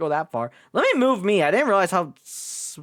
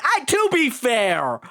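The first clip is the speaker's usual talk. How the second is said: flustered high squeak; 10 semitones higher, slower than usual. Acted.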